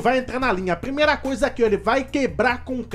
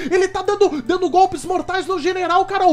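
high, loud alarmed telling; panicked calling out; 6 semitones higher, 4 dB louder than usual. Acted. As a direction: call out to wife in a panic. Panicked report of kid fighting.

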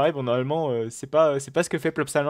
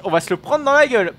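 alarmed; 10 semitones higher, 7 dB louder than usual. Real, not acted. Stuck train ahead on track.

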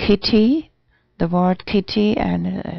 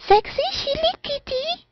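tiny kitten voice, very high; high kitty voice. Acted. Streamer announcing her new Twitter account, in a high kitty voice. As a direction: jump way up into a small-cat voice. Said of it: "cabe muy bien esa voz alta".

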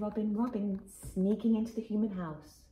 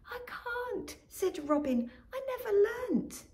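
high anxious protest; panicky little voice; 12 semitones higher than usual. Acted. Robin panics she cannot fly. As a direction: plead helplessly.

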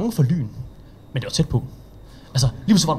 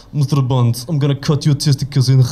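put-on thick Russian Bond-villain accent, a bit louder; 3 dB louder than usual. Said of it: "de laver bare en russisk accent".

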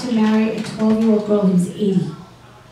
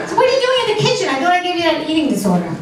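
high, fast mocking retort; mock mom comeback; 7 semitones higher, much faster than usual. Comic mom answering her teen daughter, fast mocking tone. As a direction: fire the comeback back quick and high. Mom's comeback in acted quote.

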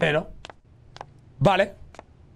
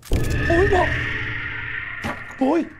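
high startled gasp of a yelp; 8 semitones higher, much slower than usual. Real, not acted. Gamer startled mid-game, high startled yelp. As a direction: jump in fright.

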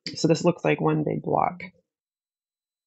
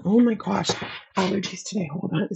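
raspy whisper; 3 semitones higher than usual. Real, not acted. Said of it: "See, again, I have no voice".

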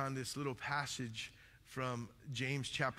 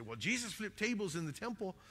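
higher-pitched mock protest; mimicked objection; 7 semitones higher than usual. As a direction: pipe up like someone making an excuse. Voicing people who justify anger.

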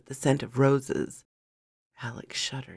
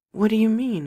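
higher, louder, mildly startled; acted confusion, pitched up and pressing; alarmed question; 7 semitones higher, 8 dB louder, faster than usual. A narrator's reading. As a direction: ask it in sudden disbelief. Alarmed question in story dialogue.

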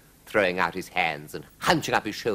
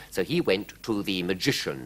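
usual speaking voice

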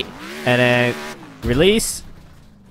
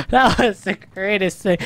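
talking through laughter; high, giggly enthusiasm; laughing delight; 4 semitones higher than usual. Real, not acted. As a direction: get it out while cracking up. Laughing after the crash.

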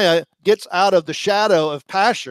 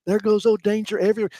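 mocking taunt; 6 semitones higher, much faster than usual. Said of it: "They're making fun of him".

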